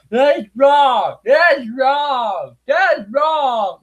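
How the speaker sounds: high mock shouting; mock outraged shout. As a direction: yell it like an indignant cartoon bigot. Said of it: "blah, blah, blah".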